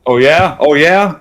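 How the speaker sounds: loud, high, goading questions; playful taunting shout; mock challenge. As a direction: call their bluff at full volume.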